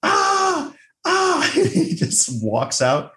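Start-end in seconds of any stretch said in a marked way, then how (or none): 0.0-1.5 high-pitched mock screams; repeated shrieks at a contained volume, imitating a meltdown; imitated scream. Host imitating a student who snaps while cramming, mock screaming. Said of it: "he keeps yelling".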